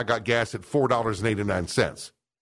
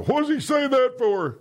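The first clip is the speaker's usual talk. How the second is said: high, slow puzzled listener voice; mock listener voice; 12 semitones higher, slower than usual. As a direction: squeak it like a baffled listener. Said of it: "A lot of you go".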